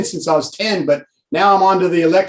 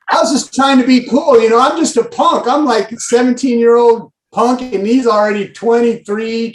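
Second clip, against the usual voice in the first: ordinary talk, nothing marked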